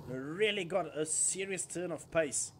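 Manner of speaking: ordinary talk, nothing marked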